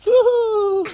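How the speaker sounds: high squealing whoop into laughter; excited laughing whoop. Real, not acted. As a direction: whoop then burst out laughing. Excited shriek while picking.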